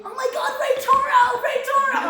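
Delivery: high, loud fangirl scream of a name; imitating mom screaming. Mocking mom's starstruck scream at the concert, loud and high. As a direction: shriek the name like a starstruck fan. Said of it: "turns around to scream".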